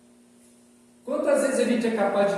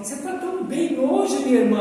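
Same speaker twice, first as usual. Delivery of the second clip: higher, bright-eyed praise of her mood; a friend compliments a sister at work, higher and admiring; 5 semitones higher, 5 dB louder than usual. Retold or imitated. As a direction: gush over how well she looks. Someone compliments a happy woman.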